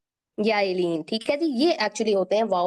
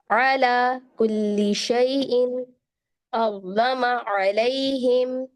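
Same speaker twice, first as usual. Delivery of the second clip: slow measured Arabic recitation, throaty ain; Quran recitation; 2 semitones higher, much slower than usual. Shown as a demonstration. Teacher recites the verse to practise ain. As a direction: recite the verse slowly, pressing every ain.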